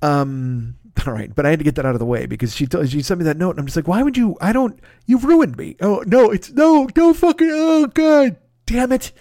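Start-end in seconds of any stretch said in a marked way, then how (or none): 3.9-9.1 high wailing protest, building louder; hammed-up horror, as if being violated; mock anguish. Acted anguish at being ruined.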